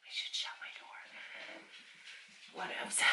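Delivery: slow, soft whisper to herself; muttering to herself after calling the dog; whispered aside. Real, not acted. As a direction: breathe it out to yourself.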